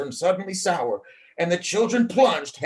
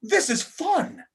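excited; 13 semitones higher, slower than usual. A narrator's reading. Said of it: "Samantha exclaimed".

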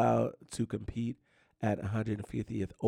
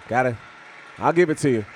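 loud concession; 4 semitones higher, 4 dB louder, faster than usual. Real, not acted. Conceding loudly to rival team.

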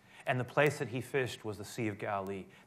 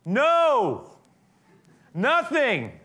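calling back; 12 semitones higher, 9 dB louder, much slower than usual. Acted. Disciples shout back from boat.

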